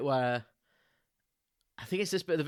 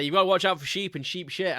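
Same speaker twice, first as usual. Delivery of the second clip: joking warning; 5 semitones higher, 6 dB louder than usual. Retold or imitated. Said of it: "And I say".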